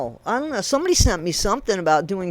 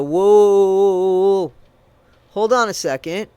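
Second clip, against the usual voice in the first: mock alarmed media voice; 7 dB louder than usual. Imagining mainstream media stopping the story, loud mock alarm. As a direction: call a halt like a newsroom catching itself. Voicing what media should say.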